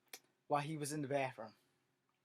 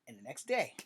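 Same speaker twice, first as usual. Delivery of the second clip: usual speaking voice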